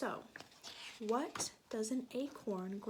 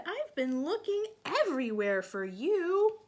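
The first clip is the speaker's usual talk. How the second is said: character voice; 8 semitones higher, 9 dB louder than usual. Acted. Character quote in story.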